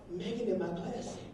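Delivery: very soft, secretive whisper; hushed little tattletale; child's whisper. Retold or imitated. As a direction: whisper it like a little kid to Daddy. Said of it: "she'll whisper it".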